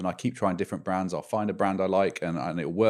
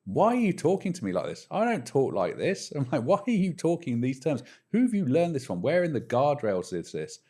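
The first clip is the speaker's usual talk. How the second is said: higher, exasperated mock rant at the chatbot; retold frustration; 8 semitones higher than usual. Late-night user scolding the AI for its jargon, higher exasperated retelling. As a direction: replay the frustration, pitched up and pleading. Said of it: "at one point I got really frustrated and said".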